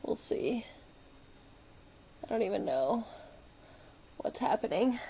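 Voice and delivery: breathy whisper to herself while fiddling; under her breath, half to herself; whispered aside. Real, not acted. Crafter to herself while fixing her camera, whispering.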